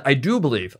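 "I do believe" with "do" pressed firmly; stress on do. Retold or imitated. Explaining the father's faith, stress on "do". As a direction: lean on the word "do" in "I do believe". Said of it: "He emphasizes that".

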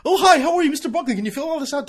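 high, loud chirpy receptionist voice; high sing-song front desk greeting; mock receptionist. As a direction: play the too-cheerful front desk. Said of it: "fill out this bevy".

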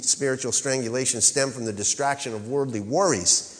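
usual speaking voice

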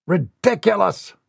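high, punched retort; indignant retort. Acted. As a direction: shoot it back at the butler. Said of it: "Mortimer shot back".

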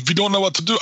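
put-on half-joking German soldier accent. Units sitting idle in the wargame, in a hammed-up German accent. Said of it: "I don't know why my soldiers just became Germans".

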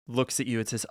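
normal talking voice

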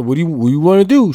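lightly voiced other driver asking; imitating the driver. Driver pestering the comedian after the crash: mock whine. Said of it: "he kept doing the".

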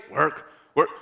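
mock groggy "Work?"; drowsy question. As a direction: say "Work?" half asleep, not yet grasping. Sleepy friend hears about work.

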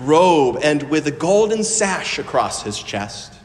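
slow solemn reading voice; scripture reading. Acted. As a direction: read it as holy scripture.

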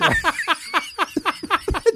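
a high, bursting laugh at his own story. Real, not acted.

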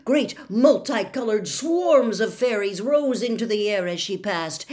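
usual speaking voice

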